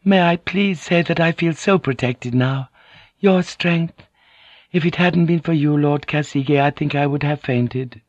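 very high, apologetic lady; submissive, wide-eyed apology; meek, flattering noblewoman. A narrator's reading. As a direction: fawn in a high feminine voice.

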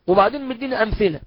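ordinary talk

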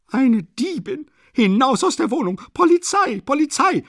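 high, loud screeching howl. A narrator's reading. Landlady howls at Sonja to get out, screeching. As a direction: howl for the police at the top of your voice. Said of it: "heulte Amalia Iwanowna".

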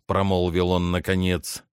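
usual speaking voice

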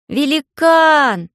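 loud, high, drawn-out call. A narrator's reading. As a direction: call into the forest. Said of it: "позвала Алиса".

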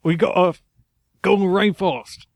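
high, put-on English bird; unconvincing English accent. Bird urges Blue to the rainforest, high English accent. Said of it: "very Englishmen".

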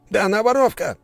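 high, loud aristocrat's cry; shrill-pitched outraged shout; shouted accusation. A narrator's reading. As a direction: yell the accusation out. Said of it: "снова закричал аристократ".

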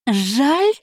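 high, rising scoffing "Жаль?"; incredulous grumble. Acted. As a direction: throw "Жаль?" back in disbelief, pitch shooting up. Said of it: "буркнула та".

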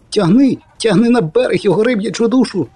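high, strained battle cry; triumphant hauling cry. Acted. Poet yelling at Geralt to land the fish, high and triumphant. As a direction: cheer the haul like a hunt.

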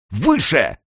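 high, fierce command; raging order. A narrator's reading. Kern raging at the man below, fierce cry. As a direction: rage at him to obey. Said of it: "буйствовал Керн".

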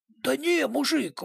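surprised, a bit baffled. A narrator's reading. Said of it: "удивлённо произнёс".

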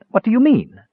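high-pitched nervous question. A narrator's reading. Palin fears the dwarf's news, asking nervously. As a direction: ask it anxiously, voice lifted high. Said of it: "Palin asked nervously".

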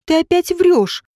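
mild angry cry. A narrator's reading. Женька accusing Родион again, mild outcry. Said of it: "крикнула Женька".

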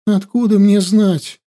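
a sob caught in the words; sobbing. A narrator's reading. Princess doubting the chief through tears, sobbing. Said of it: "всхлипнула царевна".

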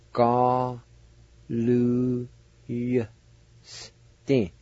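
drawn out Ga-lu-yas-tin, each syllable held very long with a faint Y; slow syllable-by-syllable Galusti. Shown as a demonstration. Teacher sounding out Ga-lu-yas-tin very slowly for learners. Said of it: "You just kinda pronounce the Y sound like this".

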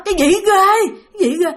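high, loud grumpy question; loud sleepy demand. Retold or imitated. Woken brother loudly asks his frightened sister what's wrong: loud, high. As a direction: blurt it out too loud, half awake. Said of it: "anh đừng có la lớn".

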